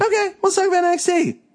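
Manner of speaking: high, fast squeaky mimic of the co-host; mocking parrot. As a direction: parrot his line back way up high to tease.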